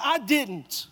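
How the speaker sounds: normal talking voice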